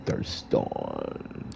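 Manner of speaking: a lightly voiced composed vampire agent; measured report to the prince; low, quiet report. Kadir reports to the court.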